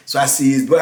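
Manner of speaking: ordinary talk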